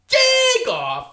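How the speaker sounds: announcer voice. Pretend. Announcing the segment title again.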